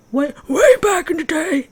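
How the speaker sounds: high, loud put-on old man voice; old man's voice. Vlogger joking about running long ago, old man's voice. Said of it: "that's my old, old man's voice".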